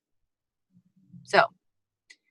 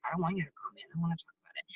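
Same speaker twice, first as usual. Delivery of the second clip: much softer, mumbled refusal; reluctant prospect; 4 semitones lower, 15 dB quieter, much faster than usual. Retold or imitated. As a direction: mumble it like someone dodging the call. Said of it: "they're gonna be like".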